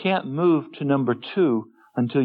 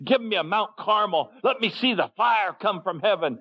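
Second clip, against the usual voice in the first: pitched-up, pleading voice of the people; demanding crowd; 8 semitones higher than usual. Retold or imitated. The people demand a sign, high and pleading. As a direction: beg for fire from heaven.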